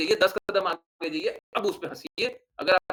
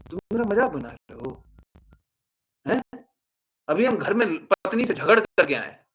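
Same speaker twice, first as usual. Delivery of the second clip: a half-acted upset man, louder in the middle; mock indignant rant, a touch louder; aggrieved outburst; 4 dB louder than usual. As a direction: play the offended man who was mocked, rising to a complaint. Angry man confronting the mocker.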